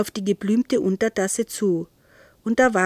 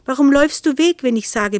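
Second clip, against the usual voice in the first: scolding, starting high and loud, then easing down; indignant telling-off; cross snarl; 5 semitones higher, 5 dB louder, faster than usual. A narrator's reading. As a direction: snap a reproach, then drop to usual pitch. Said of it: "fauchte Nero".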